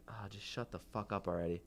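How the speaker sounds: a quiet aside you don't want him to hear; muttered thought. Retold or imitated. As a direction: mutter it to yourself, fed up. Inner thought about annoying customer.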